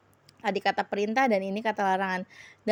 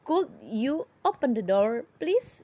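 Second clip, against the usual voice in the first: polite request. Shown as a demonstration. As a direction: soften it into a polite rising request. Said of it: "would itu lebih sopan lagi".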